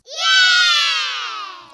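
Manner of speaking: very high, thin squeal of joy; all-caps yell. Acted. Reading the all-caps cheer in the call, very high squeal. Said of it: "All caps".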